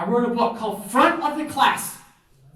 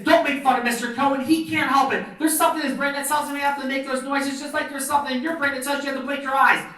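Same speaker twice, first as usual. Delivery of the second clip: put-on higher-pitched kid sticking up for someone; indignant student; 5 semitones higher than usual. Student defending a teacher from a kindergartner's mocking, indignant high voice. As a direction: scold in a raised, insistent child's pitch. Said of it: "One of my students got out of line".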